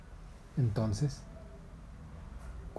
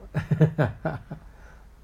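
real laugh; 5 semitones higher, 6 dB louder than usual. Real, not acted. Narrator laughs.

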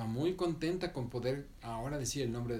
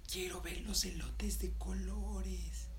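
higher, slow, longing whisper; 8 semitones higher, 4 dB quieter, slower than usual. Acted. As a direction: Patinina whispers her wish to Mandarina, slow and hushed. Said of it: "Y Patinina dijo".

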